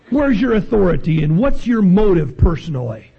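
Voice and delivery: high, ringing preacher's voice; full-force preaching. Acted. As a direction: preach it up to the rafters.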